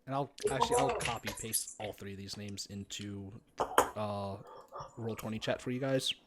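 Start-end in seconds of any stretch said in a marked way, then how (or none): 1.4-6.1 whispered aside. Real, not acted. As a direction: murmur it under breath.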